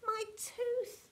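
high pained whine; hurt, high-pitched complaint; cried in pain. A narrator's reading. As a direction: wail about it in a high voice. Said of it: "he cried".

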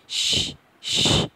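a long hissing unvoiced শ, শ with no voice at all; sh sound demo. Shown as a demonstration. Teacher demonstrating the sound to students, long unvoiced hiss. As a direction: hold the শ as a breathy hiss. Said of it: "এমন টাইপের একটা শ".

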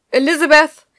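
loud, high shout of a name; calling from downstairs. A narrator's reading. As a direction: yell up the stairs for her. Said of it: "rief jemand von unten".

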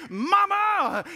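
high loud "Mama" call; hollering child. Retold or imitated. Three-year-old hollering to his mother, loud and high. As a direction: holler up like a boy in a cart. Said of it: "stood up in the seat and hollered".

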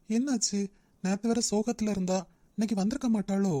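soft, private musing while waiting; hushed, uncertain self-talk; wondering to himself. Acted. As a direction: think aloud quietly, a little worried. Man waits, wondering to himself.

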